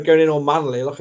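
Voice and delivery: ordinary delivery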